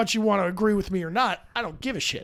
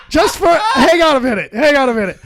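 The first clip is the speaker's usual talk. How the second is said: laughing; 7 semitones higher, 9 dB louder than usual. Real, not acted. Laughing at a shamrock tattoo.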